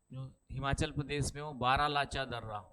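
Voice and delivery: ordinary talk, nothing marked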